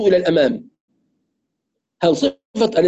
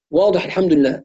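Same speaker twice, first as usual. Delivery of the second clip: ordinary talk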